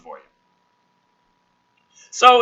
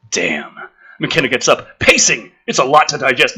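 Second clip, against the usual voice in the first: usual speaking voice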